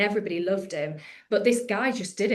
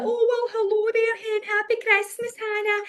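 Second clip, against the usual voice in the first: very high Scottish granny voice; 14 semitones higher, 3 dB louder than usual. Acted. Granny greeting Hannah on Christmas Day, high Scottish accent. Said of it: "then my granny be like".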